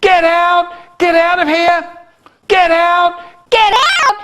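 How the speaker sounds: very high, loud panicked scream. Acted. Man warning the audience to get out, screaming. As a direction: scream at everyone to flee. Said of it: "And he screamed".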